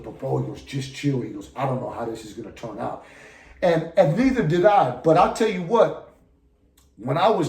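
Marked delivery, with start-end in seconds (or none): 0.0-3.0 soft, lower retold reply; low-key calming. Inmate telling the storyteller to chill, low-key calming. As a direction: imitate someone quietly talking a friend down.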